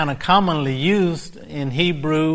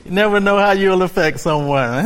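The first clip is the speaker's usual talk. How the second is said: usual speaking voice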